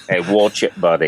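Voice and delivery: a barely there Australian accent, hammed-up; playful mock Australian accent, only lightly put on; bad Australian accent. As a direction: toss off a half-hearted Aussie "watch it, buddy". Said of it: "the worst Australian accent I've ever done".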